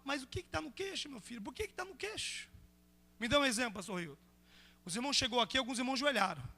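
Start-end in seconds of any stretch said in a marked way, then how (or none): 0.1-2.5 soft, questioning Jesus; quietly puzzled, gently questioning a child. Acted. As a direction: ask softly, lifting the question. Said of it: "Mas aí Jesus fala".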